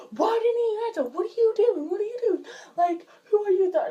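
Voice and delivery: high, loud, mock-frantic grilling; angry interrogation. Parent demanding why the calls went unanswered, high and loud. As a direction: fire the questions like a panicked parent.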